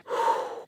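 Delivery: heavy breathy sigh; exasperated sigh. Retold or imitated. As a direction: let out a long exhale. Sigh after retold order.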